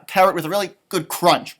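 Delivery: ordinary delivery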